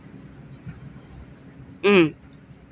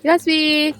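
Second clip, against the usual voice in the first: ordinary talk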